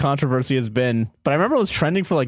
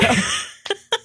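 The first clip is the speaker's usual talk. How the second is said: very high squeal of laughter. Real, not acted. Laughing at the weird meal story, a squealing laugh. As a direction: let out a shrill laughing squeal.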